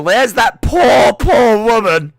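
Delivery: loud, high, slowed gleeful sympathy; gloating sympathy while watching the replay; gleeful pity. Real, not acted. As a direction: stretch it out with glee. Replay shows the woman.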